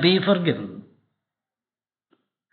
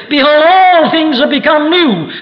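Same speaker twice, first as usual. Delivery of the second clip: rising to a loud, high proclamation; proclaiming; 9 semitones higher, 5 dB louder than usual. Acted. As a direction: ring it out like a prophet. Climactic scripture quote in sermon.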